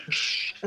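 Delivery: hushing "shh" in mimicry; play-acted hush; acted shushing. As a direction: shush someone, all breath. Re-enacts the character shushing.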